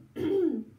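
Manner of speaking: rough throat clear. Real, not acted. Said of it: "I have a little bit of a cold".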